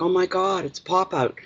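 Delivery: put-on excited kid. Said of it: "and Tim's like".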